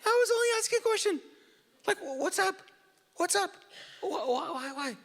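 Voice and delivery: very high-pitched mock protest; hammed-up squeal of a blamed little brother; whiny younger sibling. David protesting to his older brother, in a squeaky sibling whine. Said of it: "younger sibling response".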